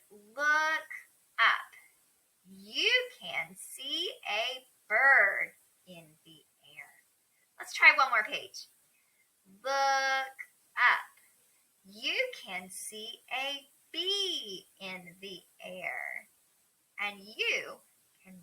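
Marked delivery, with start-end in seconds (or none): 0.1-7.0 slow, word-by-word early-reader voice; measured read-aloud with gaps between words; reading to children. Acted. Reads beginner book aloud. 9.6-16.3 slow, word-by-word early-reader voice; measured read-aloud with gaps between words; reading to children. Acted. Reads beginner book aloud.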